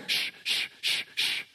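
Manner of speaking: sharp repeated shushes, all breath, no voice; mother's shushing. Retold or imitated. Mother hushing her pestering son during the music, voiceless shushing. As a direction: hush a child with quick hissed shushes. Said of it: "every time his mother would say".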